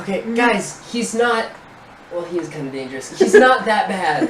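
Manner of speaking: mostly high, pleading reassurance; urgent reassurance. Acted. Player calming scared kids about the robot, urgent reassurance. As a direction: talk panicky kids down.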